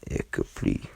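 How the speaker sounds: ordinary delivery, nothing marked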